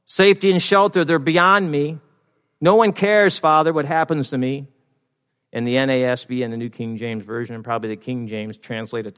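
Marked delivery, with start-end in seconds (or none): 0.0-4.7 loud, high-pitched lament to the Father; anguished plea. Acted. David crying to his Father that no one cares, anguished plea. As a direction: cry out the psalm, raised and forceful. Voicing David's despairing cry to God.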